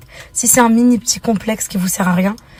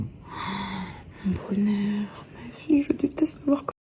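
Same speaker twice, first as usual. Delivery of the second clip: soft loving whisper; tender whisper; 10 dB quieter, much slower than usual. Real, not acted. Tender whisper to her child.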